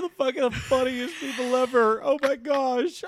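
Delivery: high, loud, hammed-up delight; mock hysterical glee. Imagining themselves replaying the podcast, mock hysterical delight. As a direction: squeal like we're the funniest people ever. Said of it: "We're gonna be like".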